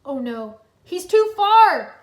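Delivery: building from urgent to a slow high cry; urgent exclaim. A narrator's reading. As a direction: push harder, then cry out in dismay. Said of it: "Kai exclaimed".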